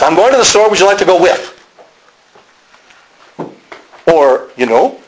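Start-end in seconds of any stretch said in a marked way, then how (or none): 0.0-1.6 raised-pitch Chicago imitation; Chicago accent. Preacher repeating Chicago locals' response, Chicago accent. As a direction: mimic a Midwestern Chicago local. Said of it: "But that's their response".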